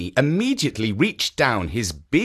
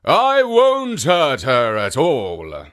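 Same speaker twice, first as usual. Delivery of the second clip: ogre voice; 5 dB louder, slower than usual. Acted. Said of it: "promised the Oni".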